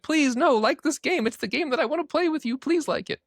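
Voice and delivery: high, pleading squeak; mock begging. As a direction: beg them like a desperate kid. Begging people to like game.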